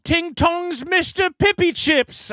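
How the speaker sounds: high, slow mocking voice; sneering impression of a critic; mocking Milo. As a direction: parody him with a slow, high whine. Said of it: "He's like".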